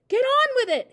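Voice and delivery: high, loud, impatient order; impatient hiss. A narrator's reading. Mother prodding her frozen husband, impatient voiced hiss. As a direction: prod him sharply into moving. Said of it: "hissed the mother".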